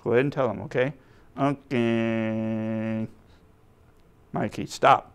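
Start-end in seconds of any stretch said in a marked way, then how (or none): 1.4-3.1 long, drawn-out puppet reply; lightly voiced puppet drone; puppet character. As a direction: stretch it out as a reluctant puppet. Puppet Mikey answers the host.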